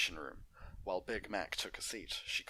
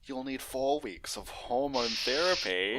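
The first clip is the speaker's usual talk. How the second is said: Doctor Jenny; 6 semitones higher, 8 dB louder than usual. Acted. Doctor explaining procedure.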